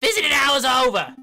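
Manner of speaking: high, loud guard refusal; angry guard. Acted. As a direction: bark the refusal.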